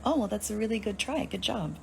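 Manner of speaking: high, sugary mock encouragement; sarcastic praise. Answering the college-radio guy, sweetly sarcastic. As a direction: pat him on the head with your voice.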